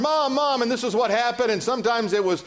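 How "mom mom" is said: high, urgent kid's call, retold; little kid calling Mom. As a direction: imitate a child crying out for mother. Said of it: "I run into her arm".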